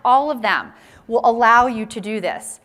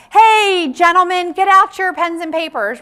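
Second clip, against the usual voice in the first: mock call to the room; 7 semitones higher, 7 dB louder than usual. Speaker acts a presenter calling.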